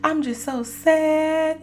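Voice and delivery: very high, slow, whimpering wail; so sad. Retold or imitated. Telling mom on the phone, high slow wail. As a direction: stretch every word out pitifully. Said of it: "all sad".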